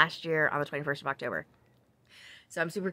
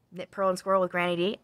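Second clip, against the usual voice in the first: usual speaking voice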